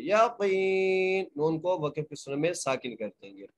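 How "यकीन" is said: very slow इल्मल यकीन, ain joined to lam; Quranic recitation. Shown as a demonstration. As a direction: draw out यकीन. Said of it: "एक अलिफ की मकदार खींच".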